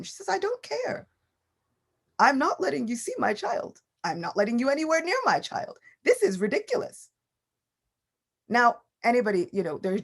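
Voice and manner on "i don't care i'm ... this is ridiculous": protective mother. Acted. Mother refusing Lord Shiva at the gate, high and indignant. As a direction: shut him down, nobody sees my baby.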